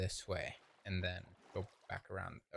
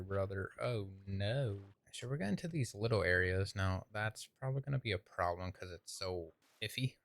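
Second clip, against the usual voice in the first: normal talking voice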